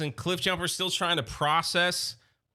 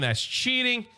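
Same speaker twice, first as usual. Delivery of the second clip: higher, retold cry of outrage; mock screamed protest; 8 semitones higher than usual. Starscream screaming at Soundwave, mock high protest. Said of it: "Starscream is screaming".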